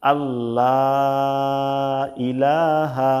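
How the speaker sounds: drawn-out chanted phrase; slow recitation. Shown as a demonstration. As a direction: hold the vowels as in recitation. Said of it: "Hamza লাম জবর আল".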